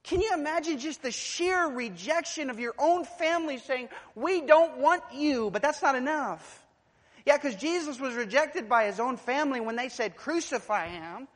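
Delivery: loud, high pulpit cry; pounding sermon voice; full-force preaching. Acted. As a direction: drive it home to the congregation.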